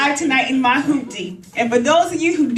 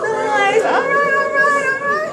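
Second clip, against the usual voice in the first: playful cheer; 11 semitones higher, slower than usual. Real, not acted. Teasing singles, rising cheer.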